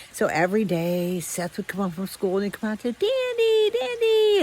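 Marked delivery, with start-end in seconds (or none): 2.9-4.4 high sing-song call across the yard. Retold or imitated. Boy calling his dog from the yard in a high sing-song call. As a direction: call the dog home, pitched way up.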